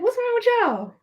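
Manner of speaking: high-pitched retold puzzlement; mock bewilderment. Retold puzzlement at silent table.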